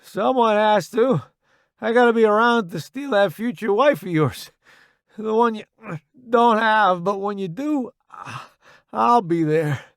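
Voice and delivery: high, loud voice through pain; cocky banter, effortful and halting; wounded joking soldier, strained. A narrator's reading. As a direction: tease a buddy while hurting. Johnson, wounded, jokes with Roddy.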